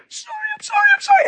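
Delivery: very high squealed sobbing apology; mock hysterical crying. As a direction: imitate a wife wailing uncontrollably, words repeating. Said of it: "crying out of control".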